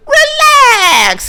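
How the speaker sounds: very high, loud cartoon cry; loud, drawn-out, squealed impression; Trump. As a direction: stretch it into a squealing shout. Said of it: "he's been doing that voice this whole show".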